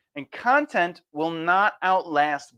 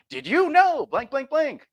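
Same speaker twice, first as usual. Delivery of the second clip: much higher sing-song template line; mock clickbait hook; 9 semitones higher than usual. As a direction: parody the formula hook. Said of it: "what is it?".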